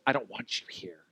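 low, hushed imagined subtext; hidden hostility. Retold or imitated. What was behind that hello, quietly hostile. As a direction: voice the unspoken rejection under your breath. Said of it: "What was behind that was".

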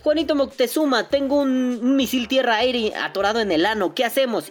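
high, urgent comic plea; mock panic plea. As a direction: beg for help in mock panic. Pide ayuda dramáticamente. (súplica de pánico fingido)

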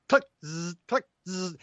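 mouthed shutter click and motor-drive buzz; click, then buzz like a film winder; imitated camera clicks. Recalling a photographer's motor drive, mouthed click and buzz. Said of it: "this guy with the camera going".

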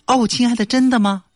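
high, mock sweet fiancée; high-voiced fiancée. (高声撒娇的未婚妻)

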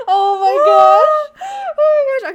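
high, loud, drawn-out gushing; real excited squeal. Real, not acted. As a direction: squeal it, overwhelmed by the scene. Squealing over the kiss scene.

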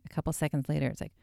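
usual speaking voice

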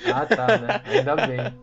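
laughing high while talking; words squeezed out through laughter. Real, not acted. Laughing at friend's confession.

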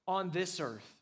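ordinary talk

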